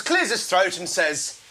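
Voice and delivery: normal talking voice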